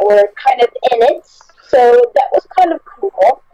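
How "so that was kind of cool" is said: normal talking voice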